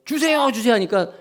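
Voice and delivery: high-pitched, pestering son; insistent begging. Acted. Son keeps begging for money. (높은 목소리로 조르는 아들)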